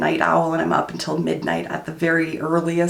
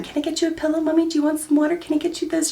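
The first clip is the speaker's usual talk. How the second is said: put-on much higher, eager little girl voice; little mother hen; 10 semitones higher, much faster than usual. Mother imitates her daughter fussing over her, high eager little girl voice. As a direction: fuss over Mom like a tiny helpful nurse. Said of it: "Aspen is like a little mother hen".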